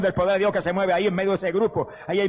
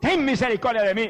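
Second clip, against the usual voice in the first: trumpet-like cry; 7 semitones higher than usual. Acted. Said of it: "levantó la voz como una trompeta". (clamor alto como trompeta)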